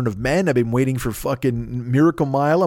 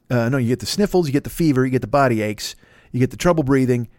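ordinary talk

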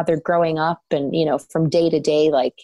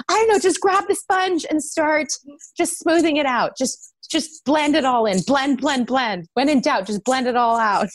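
frantic makeup coaching; 8 semitones higher than usual. Retold or imitated. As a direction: coach him through it in a rush of panic. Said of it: "And I was like".

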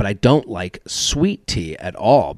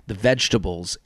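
ordinary talk, nothing marked

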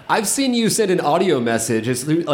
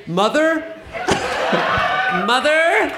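raised, rising call into the crowd; 9 semitones higher, much slower than usual. Pretend. Comic calling out to his mother somewhere in the audience, high calling voice.

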